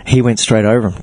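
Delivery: ordinary talk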